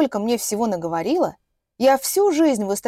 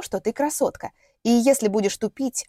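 ordinary delivery, nothing marked

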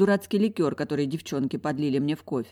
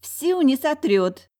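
high, loud, drawn-out reassurance; supportive friend; 10 semitones higher, 5 dB louder, much slower than usual. A narrator's reading. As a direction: cheer your friend on in a raised, slowed voice. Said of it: "поддержала Лариса". (громко, высоко и протяжно подбадривая)

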